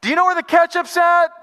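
high, loud call from another room; yell from kitchen. Retold or imitated. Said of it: "hear somebody yell from the kitchen".

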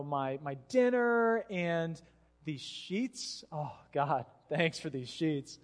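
slow, drifting off mid-prayer; drowsy bedtime prayer. Acted. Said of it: "So I'd lay in bed".

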